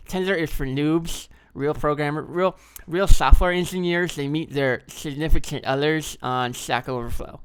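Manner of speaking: nerdy slow programmer voice; hammy nerd voice, drawn out. Acted. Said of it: "this is my nerd programmer voice".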